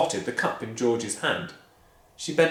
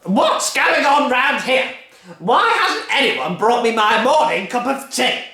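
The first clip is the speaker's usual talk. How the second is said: bully the whole family; furious Grandma; 9 semitones higher, 7 dB louder than usual. Acted. Grandma demanding her breakfast, loud bullying bellow. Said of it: "and she shouted".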